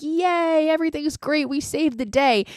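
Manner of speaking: sing-song "yay" held high in pretend triumph; mock cheering scream. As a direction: imitate a crowd of gleeful kids cheering. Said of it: "like, scream".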